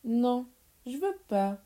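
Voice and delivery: put-on revolted child's protest; disgusted cry. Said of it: "s'écria Pierre dégoûté".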